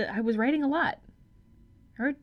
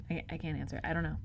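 quiet; 6 semitones lower, 9 dB quieter, faster than usual. Real, not acted.

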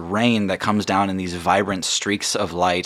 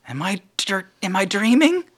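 put-on child voice; 11 semitones higher than usual. GM voices the amazed kid.

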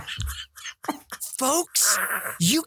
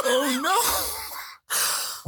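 horrified; 10 semitones higher than usual. Real, not acted. Reacting to hornet news.

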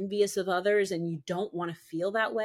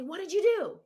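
sharp accusing question; 12 semitones higher than usual. Retold or imitated. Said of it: "He didn't say".

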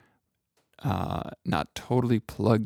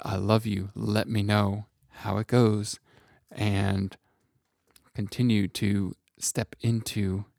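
ordinary delivery

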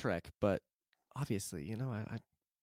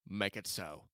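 a low, softer captain's order, badly imitated; Picard; 6 semitones lower, 7 dB quieter than usual. Shown as a demonstration. Host trying the famous command, bad impression. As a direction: lightly attempt a starship captain, pitched down. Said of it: "it's a bad Picard is what it is".

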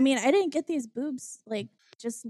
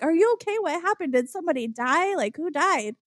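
raised-pitch imitation of a worried mom; alarmed mother; 6 semitones higher than usual. Mom asking crying daughter what happened, mock alarm. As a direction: fire off worried questions, higher at first.

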